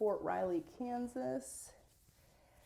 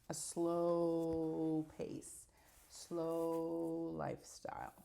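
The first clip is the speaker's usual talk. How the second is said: drawn-out slow; much slower than usual. Shown as a demonstration. Describing slow city life.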